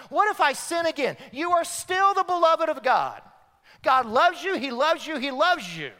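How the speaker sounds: loud, high, driving preacher's cadence; full-force preaching. Acted. Pastor answering the doubts of the congregation, preaching loud. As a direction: preach it out, building reassurance.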